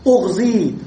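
اغزي begun with u, ending in long i, "ughzi"; damma then kasra. Shown as a demonstration. Teacher showing the feminine command, اغزي said u first, i last. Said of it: "فالهمزة مضمومة والثالث مكسور".

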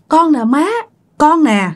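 a hint of a pleading child; daughter answering mother. Returned daughter answers her mother.

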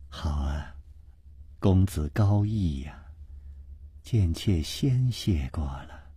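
low, soft, slow consort's voice; gracious thanks. A narrator's reading. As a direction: thank the guest gently and humbly. Consort gratefully thanks her guest. (低柔缓慢地道谢)

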